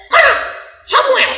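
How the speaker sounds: high, indignant demand for the name; demanding parent. Retold or imitated. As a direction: voice the angry father asking. Angry parent demands son. (orang tua marah menanyakan dengan nada tinggi)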